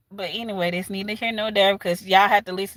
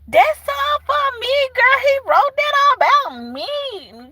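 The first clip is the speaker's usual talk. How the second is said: put-on bragging girlfriend; 13 semitones higher, 4 dB louder than usual. Imagined girl boasting to her friends, high mock brag. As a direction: play a girl proudly claiming her song, high.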